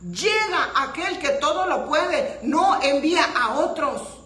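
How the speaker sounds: loud preacher's proclamation; full-force preaching. Real, not acted. Preacher proclaiming the all-powerful one to the congregation, full-force preaching.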